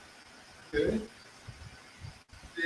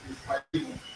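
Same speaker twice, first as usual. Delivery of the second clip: mock correction; 6 semitones higher, 10 dB quieter, much faster than usual. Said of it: "Why do they come say, 'No".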